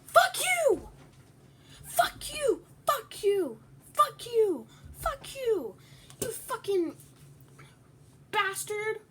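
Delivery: high, loud repeated screams of rage; real furious screaming. Real, not acted. Eaten by another player, screaming furiously. Raging at player who ate him.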